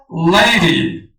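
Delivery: لیدی pronounced like English "lady"; English pronunciation demo. Shown as a demonstration. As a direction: say لیدی as "LAY-dee", final y as "ee".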